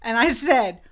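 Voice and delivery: usual speaking voice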